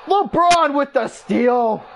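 high, stretched-out exclamation; excited call. Real, not acted. Commentator calls LeBron's steal to viewers, excited. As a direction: ring out the big steal.

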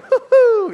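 a cartoon dough giggle; Doughboy giggle. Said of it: "Like the Pillsbury Doughboy".

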